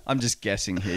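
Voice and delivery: ordinary delivery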